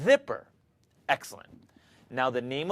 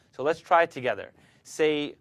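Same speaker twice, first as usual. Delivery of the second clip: normal talking voice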